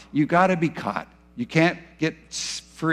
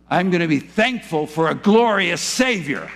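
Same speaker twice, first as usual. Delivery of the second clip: ordinary talk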